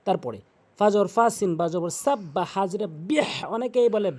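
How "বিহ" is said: বিহ with a strong, breathed final h; correct pronunciation. Shown as a demonstration. Teacher spells out the word, correct বিহ. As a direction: end বিহ on a big throat h. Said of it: "বলতে হবে বিহ".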